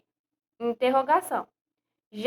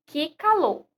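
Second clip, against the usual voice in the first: "que calor" raised high and exclaimed; exclamation tune; 5 semitones higher than usual. Shown as a demonstration. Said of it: "eu tô afirmando que está calor".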